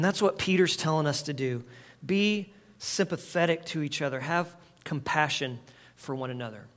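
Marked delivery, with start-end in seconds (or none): none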